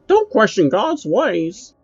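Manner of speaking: high, slow, condescending puppet voice; Pinky voice, drawn out. Retold or imitated. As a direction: stretch each word out like a smug puppet shutting someone down. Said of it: "answered with things like".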